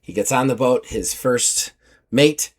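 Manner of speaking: ordinary talk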